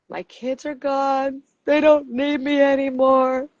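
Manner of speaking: slow, whiny pity-party lament; milk the sorrow, dragging it out; mock self-pity. Empty nester wife wallowing to herself, slow self-pity. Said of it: "I'm having my pity party".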